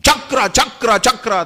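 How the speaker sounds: loud, high, repeated battle summons; thundering like clouds. Acted. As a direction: roar out the call to the divine discus. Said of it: "बिल्कुल बादल गरजता है उसी आवाज में". (बादल जैसी गरजती पुकार)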